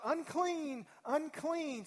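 high, loud warning cry of an outcast; crying out unclean. Retold or imitated. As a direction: call it out loud to warn people away. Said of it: "you had to yell out".

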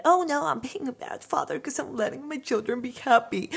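mock the king panicking about his parenting; worried father. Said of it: "got into his head that".

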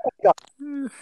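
high, drawn-out mimicry of dad; dad's shocked exclamation. Son re-enacts dad finding the mess, shocked. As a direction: stretch out dad's horror in imitation. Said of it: "he goes".

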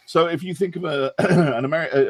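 ordinary delivery

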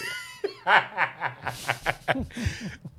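high burst of laughter. Real, not acted. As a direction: crack up at the jab. Big laugh at insult.

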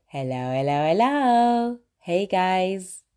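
sing-song hello with the last one leaping up; signature live greeting. Shown as a demonstration. Host opening her live stream with a sing-song greeting. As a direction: greet viewers going live, bright and rising. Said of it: "I always say".

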